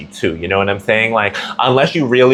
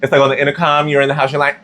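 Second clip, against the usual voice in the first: ordinary talk, nothing marked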